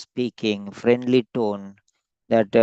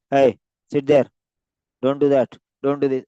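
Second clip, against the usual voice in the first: louder, bossy classroom orders; strict teacher; 4 dB louder, faster than usual. Retold or imitated. Tutor imitating a strict teacher ordering pupils, mock stern. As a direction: bark it out like a strict teacher scolding kids. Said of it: "a strict, um, teacher".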